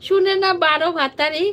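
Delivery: high, loud and excited; excited teasing. Real, not acted. Teasing a viewer about Latika, excited and loud. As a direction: rattle it off with glee.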